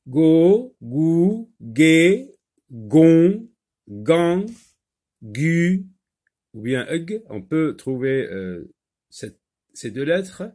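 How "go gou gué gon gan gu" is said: "Go, gou, gué, gon, gan, gu" each higher and set apart; loud, evenly spaced syllable drill with hard g; reading out syllables. Shown as a demonstration. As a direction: read each syllable out crisply, one at a time. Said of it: "Alors, lis les syllabes".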